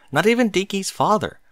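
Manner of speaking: usual speaking voice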